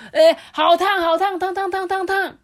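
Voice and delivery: high, loud yelps of hot; crying out hot. A narrator's reading. Busy cooks yelping about the hot pot. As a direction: yelp from the heat. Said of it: "一直喊着".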